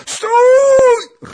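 huge high-pitched roar; full-force, strained roar; roaring old beggar. A narrator's reading. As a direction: bellow it to stop an attacker. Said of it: "ryczał dziad".

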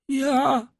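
very high, weak answer; dying man. A narrator's reading. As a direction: answer faintly in a strained high voice. Dying servant answers the doctor. (wysoki głos umierającego)

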